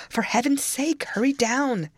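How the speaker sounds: high, pressing plea; alarmed, pitch well up; urgent exclamation. A narrator's reading. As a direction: urge them to hurry. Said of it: "I exclaimed".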